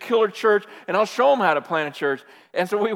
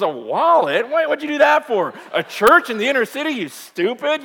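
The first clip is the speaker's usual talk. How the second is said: louder, scoffing God voice; mock scornful; 6 semitones higher, 9 dB louder than usual. Harsh heavenly father scolding, mock scorn. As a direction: sneer at the silly gift. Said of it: "and he goes".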